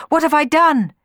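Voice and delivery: loud, high-pitched lament; despairing cry. A narrator's reading. Orpheus over Eurydice's body, despairing cry. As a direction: cry out in loss. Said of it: "cried Orpheus".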